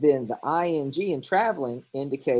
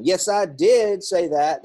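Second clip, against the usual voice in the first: Yes, I did say that with did hit hard; emphatic did; 5 semitones higher, 7 dB louder, slower than usual. Shown as a demonstration. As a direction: stress on did, louder, higher and slow. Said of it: "emphatic is the word did".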